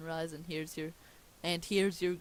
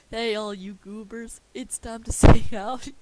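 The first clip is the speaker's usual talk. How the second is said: high, loud clock line breaking into laughter; cracking up; 5 semitones higher, 17 dB louder than usual. Real, not acted. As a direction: lose it on "howdy". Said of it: "why I'm laughing".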